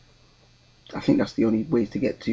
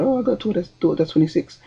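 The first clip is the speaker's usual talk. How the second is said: put-on higher-pitched go at a Scottish accent; attempted Scottish accent; 6 semitones higher than usual. Woman on the train mentions her daughter, attempted Scottish accent. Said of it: "You know, the accent, in the accent".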